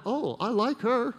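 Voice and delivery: high, slow, drawn-out sudden liking; mock delighted relief. Acting relieved delight at another's flaw.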